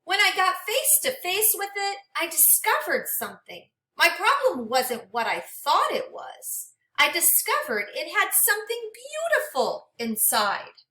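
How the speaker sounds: gentle, expressive storybook read-aloud; reading to children. Acted. Teacher reading a storybook to her class, expressive and gentle. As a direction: read it like a picture book for a class.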